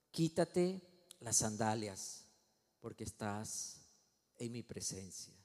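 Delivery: low, slow, measured divine voice; God's solemn command. Acted. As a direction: command slowly, voice sinking to a hush. God voiced commanding Moses.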